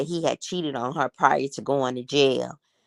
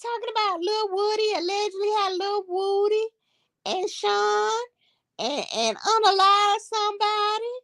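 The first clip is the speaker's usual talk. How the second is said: high, squeaky retold outburst of disbelief; exasperated squeal. Gossiping to viewers about [name], exasperated high squeal. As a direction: squeal it like you can't believe he's talking. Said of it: "I said".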